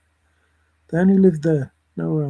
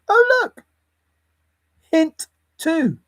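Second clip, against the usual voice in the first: much higher, louder squeal; squeaky exclamation; 10 semitones higher, 4 dB louder, slower than usual. Pretend. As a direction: squeal it out in delight.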